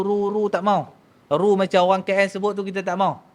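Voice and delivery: usual speaking voice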